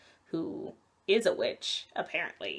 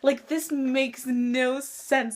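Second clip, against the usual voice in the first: baffled; 6 semitones higher, 3 dB louder, slower than usual. Retold or imitated. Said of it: "And it's like".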